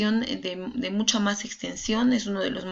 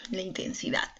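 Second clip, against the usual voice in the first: whisper to self; 6 dB quieter than usual. Real, not acted. Reading her notes under her breath.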